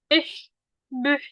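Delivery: "Ih", "beh" ending in a CH friction sound; phlegmy CH. Shown as a demonstration. Teacher demonstrating C H, phlegm-like fricative. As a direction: rasp the final sound of "Ih" and "beh". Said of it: "kayak ada dahak-dahaknya".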